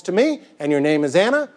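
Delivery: usual speaking voice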